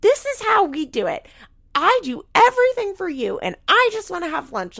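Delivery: hammed-up angry mother yelling; imitating a stepmother scolding a tired child; mock screaming Barbara. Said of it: "And she's like".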